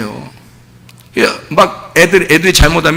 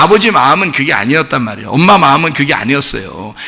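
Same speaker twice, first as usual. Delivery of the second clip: normal talking voice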